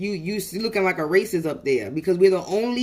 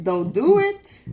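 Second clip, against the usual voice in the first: slow, higher, louder drawn-out warning; 4 semitones higher, 3 dB louder, much slower than usual. Retold or imitated. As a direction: stretch it out, half-threatening. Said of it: "that's what I said".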